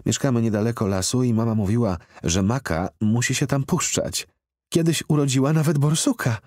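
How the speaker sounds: put-on high, fast child voice, chattering on; little girl voice. A narrator's reading. Girl tells the man about her dog, in a quick child's voice. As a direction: narrator keeps the girl's voice going.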